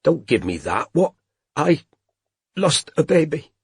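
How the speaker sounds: high, tearful confession; crying. A narrator's reading. Robin to Strike, confessing the lost baby, tearful and high. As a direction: let it spill out through tears. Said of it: "she couldn't stop crying".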